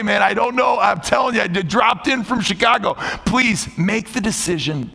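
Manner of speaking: high, pleading sermon delivery; impassioned preaching. Real, not acted. Pastor urging those sitting here to decide, impassioned preaching. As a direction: lift the pitch and urge them to decide. Preaches scripture and altar appeal.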